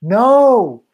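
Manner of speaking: loud, high-pitched "no"; dismayed disbelief. Real, not acted. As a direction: cry out in disbelief at bad news. Hearing devastating news about Tapia.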